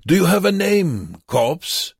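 put-on high, loud accented taunt; loud mocking question. A narrator's reading. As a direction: demand it so the guard overhears. Wernicke mockingly questions prisoner aloud.

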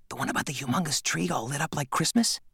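put-on a hushed, curious kid; young boy voice. Nate asks about the dream.

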